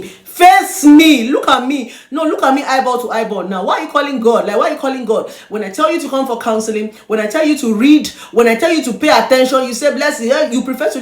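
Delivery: loud, high rebuke; stern scolding. Real, not acted. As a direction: scold them face to face. Scolding a shouting listener.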